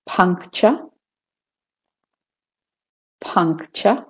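British-Australian "puncture" with a final schwa. Shown as a demonstration. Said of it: "British and Australian first".

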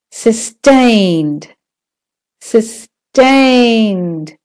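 "sustained" with a long second syllable; stress on TAINED. Shown as a demonstration. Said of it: "in the middle is longer and louder".

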